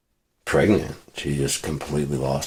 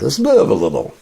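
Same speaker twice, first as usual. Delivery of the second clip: higher, louder hammed-up cheer; mock upbeat; 10 semitones higher, 5 dB louder than usual. Shown as a demonstration. Repeating a motto to the listener, hammed-up high. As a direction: sell it like a cheesy motto. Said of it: "performed line, then repeated".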